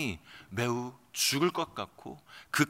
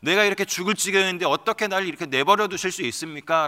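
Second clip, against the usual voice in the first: higher and louder, pleading with God; raised, anguished plea; desperate outcry; 4 semitones higher, 5 dB louder than usual. Acted. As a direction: cry out as someone near death. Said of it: "이 절규의 고백".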